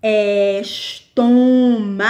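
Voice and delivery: "Estômago" slowly split as es-tô-ma-go; syllable by syllable. Shown as a demonstration. Teacher showing syllable division, "Estômago" said syllable by syllable. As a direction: break "Estômago" into drawn-out syllables. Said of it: "divisão silábica e a sílaba mais forte".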